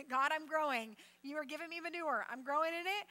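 loud, triumphant declaration to God; declaring it out loud. Shown as a demonstration. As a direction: proclaim it boldly over the junk. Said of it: "just say".

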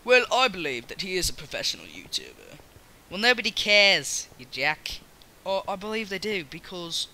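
lightly hammed British accent; put-on British accent. Acting out a debate about a YouTuber, British accent. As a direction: argue back in a mock British voice. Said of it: "I'm not even talking in the same voice".

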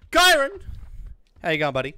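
high, loud shout-out; loud greeting. Real, not acted. Streamer greets a viewer by name, loud. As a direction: call out to the viewer warmly.

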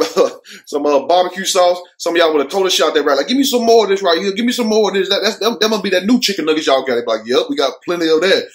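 ordinary talk, nothing marked

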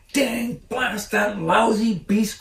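high, slow furious outburst; angry cry. A narrator's reading. Farmer Bogus cursing the fox, slow high angry cry. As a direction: spit out the curse like a cheated farmer. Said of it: "cried Mister Bogus".

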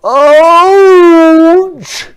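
huge, high, drawn-out yell; long, loud howl of pain; roar of pain. A narrator's reading. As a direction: roar it out as if hit in the stomach. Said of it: "roared the general".

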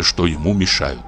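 usual speaking voice

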